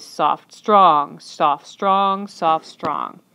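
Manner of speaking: "soft" lighter, "strong" punched, in steady pairs; alternating soft-strong stress. Shown as a demonstration. Teacher shows iambic pattern, leaning on each "strong". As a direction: beat out "soft, strong" like a tapping foot. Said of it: "use the soft symbol to show".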